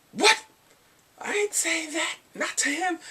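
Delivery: high-pitched outraged refusal; mock indignant disbelief. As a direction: burst out, appalled at the request. Acts out heroine refusing the word.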